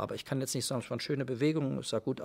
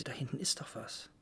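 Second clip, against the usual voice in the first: a slow, breathy whisper, as if the castle is looming out of the fog; hushed storytelling whisper; slower than usual. Real, not acted. Conductor recalling the castle appearing from the mist, whispered. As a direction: let the words fade into a hush. Evoking fog lifting at Marienburg.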